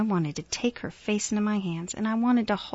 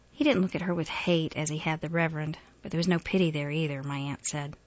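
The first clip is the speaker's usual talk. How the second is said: usual speaking voice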